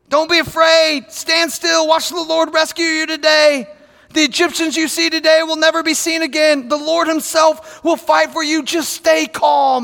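rally the frightened crowd; Moses proclaiming. Retold or imitated.

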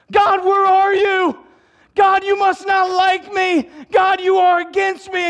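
loud, high complaint to the sky; crying out to God. Acted. Believer yelling at God that he must be against them.

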